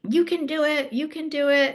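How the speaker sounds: high upbeat cheering; encouraging family. Retold or imitated. Family of skiers urging her, high cheering. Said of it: "And they're like".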